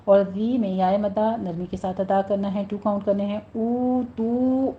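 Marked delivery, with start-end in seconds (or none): 3.4-4.7 او تو with rounded lips, واو held two counts; slow او تو. Shown as a demonstration. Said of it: "ہونٹوں کو گول رکھتے ہوئے ٹو ٹو کاؤنٹ کیجیے،".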